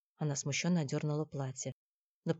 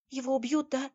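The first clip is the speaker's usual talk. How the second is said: higher-pitched anxious question; worried girl; 9 semitones higher than usual. Acted. Girl asks Никита about the chieftain, higher worried voice. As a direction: ask timidly, pitched up high.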